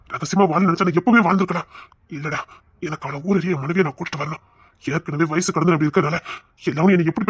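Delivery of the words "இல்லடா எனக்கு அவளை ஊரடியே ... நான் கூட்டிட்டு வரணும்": ordinary delivery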